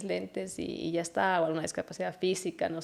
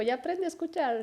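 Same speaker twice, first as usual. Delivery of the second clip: put-on much higher, childlike scolding; little son's voice; 9 semitones higher than usual. Mother imitating her son scolding her, much higher childlike voice. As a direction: mimic a small boy scolding mom.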